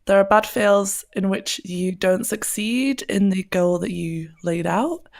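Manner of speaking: ordinary talk, nothing marked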